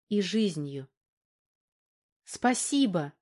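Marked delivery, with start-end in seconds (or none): 2.2-3.1 a mild happy call of thanks; joyful call. Acted. Valka, overjoyed to be home, calls out to Fyodor. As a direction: call it out with joy. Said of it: "крикнула она Фёдору".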